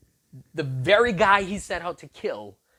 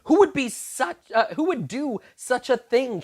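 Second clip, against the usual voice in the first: demanding king; 8 semitones higher, 5 dB louder than usual. Acted. King demands who did it.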